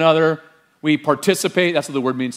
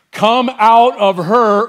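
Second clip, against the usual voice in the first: slow, loud, higher divine call; booming heavenly command; 6 semitones higher, 7 dB louder, much slower than usual. Acted. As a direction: proclaim it like God from heaven. Voice from heaven quoted.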